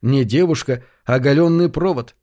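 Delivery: ordinary delivery, nothing marked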